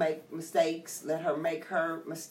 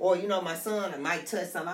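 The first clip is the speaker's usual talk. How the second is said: normal talking voice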